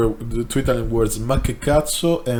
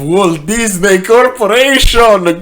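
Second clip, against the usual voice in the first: grand announcement; 9 semitones higher, 6 dB louder than usual. Acted. Grand reveal of Disney corporation.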